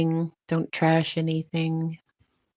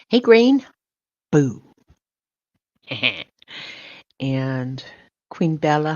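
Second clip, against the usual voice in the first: ordinary talk, nothing marked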